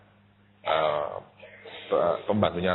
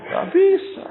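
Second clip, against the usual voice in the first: high, loud flat refusal; indignant refusal; 6 dB louder, slower than usual. Retold or imitated. Preacher refusing God, high and loud. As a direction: dig in and refuse.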